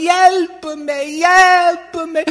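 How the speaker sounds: put-on high, loud accented yelps of a drowning child; cries for help. A narrator's reading. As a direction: scream out for rescue in a little girl's voice. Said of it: "She was going".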